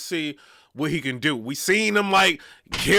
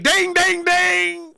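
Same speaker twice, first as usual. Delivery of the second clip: phone ding sounds; 11 semitones higher, 4 dB louder, much slower than usual. Retold or imitated. Imitating phone notification sounds.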